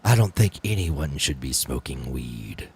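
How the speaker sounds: very low, soft, slow put-on voice; the video voice. Friend quoting JT on weed, deep slow video voice. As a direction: drop way down and draw it out. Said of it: "that's the voice".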